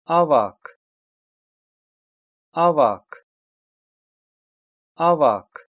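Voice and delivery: teacher's emphatic "Авак", k after the vowel; demonstrated pronunciation. Shown as a demonstration. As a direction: model "Авак" firmly, releasing the final k. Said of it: "и после гласных звуков".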